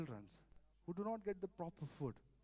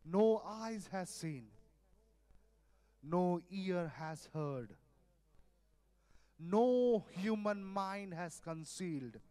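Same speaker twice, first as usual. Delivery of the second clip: scripture reading; 5 dB louder, slower than usual. Acted. Reads scripture slowly.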